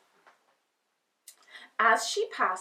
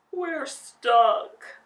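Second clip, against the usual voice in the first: sighing lion; 5 semitones higher, 9 dB louder than usual. Acted. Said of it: "sighed the lion".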